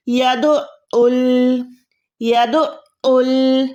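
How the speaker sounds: यदउल split by a held throat catch after "du"; slow यदउल, pressing the ain into both syllables; joined, doubled ain. Shown as a demonstration. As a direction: say "ya-du'... 'ul" for यदउल, very slowly. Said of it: "पहले दाल से साथ मिलाना है".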